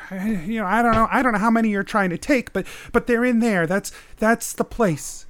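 high, hurried, stumbling nervous man. Acted. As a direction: falter and repeat yourself anxiously. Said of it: "Your father seems nervous".

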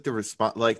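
ordinary talk

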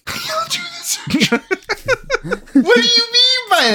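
high squeal through laughter; laughing. Real, not acted. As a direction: repeat it cracking up. Echoing quote while laughing.

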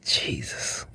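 unvoiced whisper of pain; whispered groan. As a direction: breathe it out in pain. Toothache reaction.

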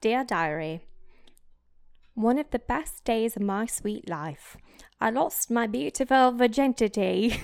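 theatrical British diary reading; dramatic British-accented diarist. Acted. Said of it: "should I do it in a British accent?".

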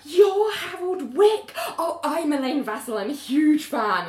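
high, loud, slow star-struck gushing; gushing fan. Retold or imitated. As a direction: fangirl over a celebrity. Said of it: "she starts gushing".

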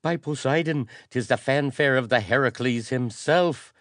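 high, loud, startled exclamation; alarmed noble. A narrator's reading. Startled noble crying out that the archpriest is coming. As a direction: cry out the alarm at the horn.

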